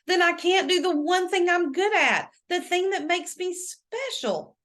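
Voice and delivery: put-on girl pleading with her lawyer, barely masking desperation; barely masked desperation. A narrator's reading. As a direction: hold back the panic, just. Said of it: "barely masking her desperation".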